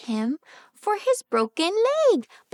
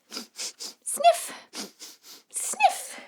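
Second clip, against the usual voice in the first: high squeaky sniffs; sniffing; 4 dB quieter than usual. Acted. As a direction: sniff like a cold. Acting out sniffles.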